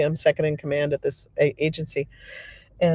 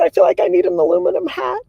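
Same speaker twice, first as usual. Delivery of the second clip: high, louder comic panic; mock freaked out; 13 semitones higher, 5 dB louder than usual. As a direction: exclaim it high, as the rattled coworker. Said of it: "I'm so afraid".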